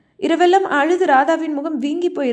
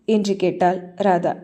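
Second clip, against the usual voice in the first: ordinary delivery, nothing marked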